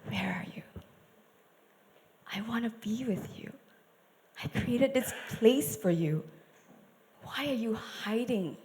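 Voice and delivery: soft, breathy pleading whisper; tender God whisper. Shown as a demonstration. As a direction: whisper it like a hurting father. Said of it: "I think he said it".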